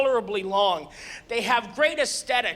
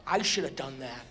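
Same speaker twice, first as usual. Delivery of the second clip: much lower, softer regretful line; envious mutter; 10 semitones lower, 6 dB quieter than usual. Retold or imitated. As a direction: mutter it with envy. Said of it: "people are staring like".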